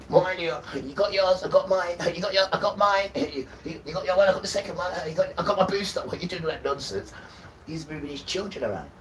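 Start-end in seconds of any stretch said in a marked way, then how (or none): 0.1-6.0 higher, laughing mock voice of people comparing jabs; mocking vaccine braggers. Mocking people who compare their vaccine doses, higher voice breaking into laughs. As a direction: send up the proud jab counters, giggling through it.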